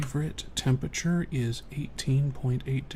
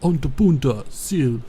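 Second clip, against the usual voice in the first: loud imitation of the meme's British slang; mock British slang; 10 dB louder than usual. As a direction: call it out in a put-on British accent. Re-enacting the British slang meme.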